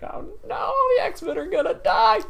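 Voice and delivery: mock distressed whimper. Fan tearing up at scene.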